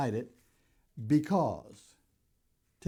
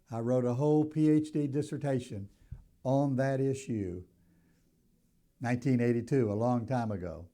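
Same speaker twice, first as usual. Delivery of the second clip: normal talking voice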